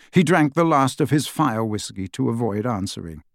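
ordinary delivery, nothing marked

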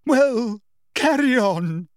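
high-pitched, loud, near-hysterical command. A narrator's reading. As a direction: burst out shrilly at the class. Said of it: "said Professor Trelawney loudly".

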